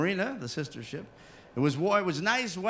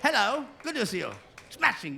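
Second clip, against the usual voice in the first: a mock-posh English greeting, pitched higher; English accent; 5 semitones higher than usual. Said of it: "So I do everybody from England".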